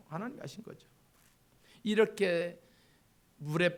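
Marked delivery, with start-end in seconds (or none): 0.1-0.9 soft hushed aside. Real, not acted. Whispered repeat of the point.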